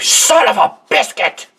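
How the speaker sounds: high, loud mock curse; cartoon character curse. Drawn angry man swearing mildly, high squeaky outburst. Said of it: "He's like".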